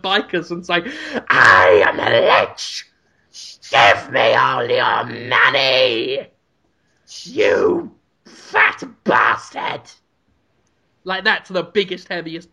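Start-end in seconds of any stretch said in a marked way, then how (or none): 1.3-9.8 low, slow undead lich shouting; shouted lich voice. Pretend. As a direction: bellow it like a rotting lich. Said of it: "Got better the longer you did it".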